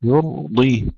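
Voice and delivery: ordinary talk, nothing marked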